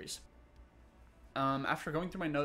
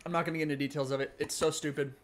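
normal talking voice

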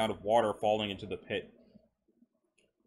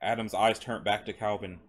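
usual speaking voice

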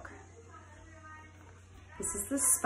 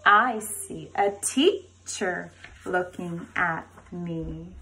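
put-on slow, sing-song chant for little kids; reading to a toddler; 2 semitones lower, 3 dB louder, slower than usual. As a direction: chant the rhyme to a class of five-year-olds. Reads book to kindergarten.

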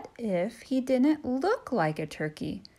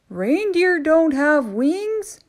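louder, higher stern elf; christmas elf; 9 semitones higher, 9 dB louder, slower than usual. Acted. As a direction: send the turkey packing. Elf voiced.

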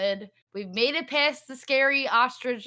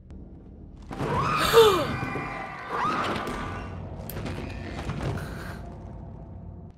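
sharp frightened gasp; scared gasp; 11 semitones higher than usual. Real, not acted. Jump scare in horror game.

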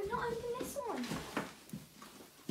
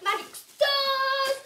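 loud high gushing joy; delighted opening a present; 5 semitones higher, 8 dB louder than usual. Real, not acted. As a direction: squeal over every item. Party gift opened.

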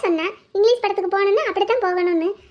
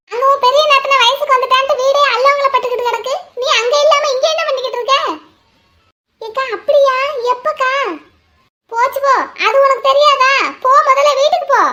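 higher, louder excited telling; loud, urgent; 5 semitones higher, 5 dB louder than usual. Acted. Woman urging Mayilu to hurry home, loud and excited. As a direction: rush her home with the news.

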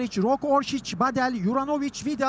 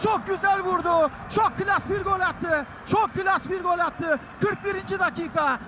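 excited commentator; 7 semitones higher than usual. Real, not acted. Goal celebration commentary.

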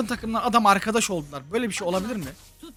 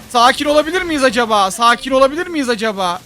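loud, raised plea for calm; agitated plea; 5 semitones higher, 6 dB louder than usual. Real, not acted. Gamer asking for calm mid-fight, agitated plea. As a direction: call for calm while worked up.

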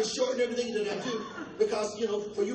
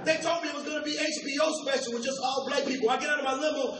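ordinary delivery

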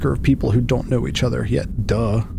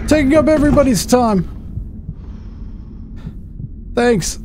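high mock voice; 14 semitones higher than usual. Mock high voice, gaming aside.

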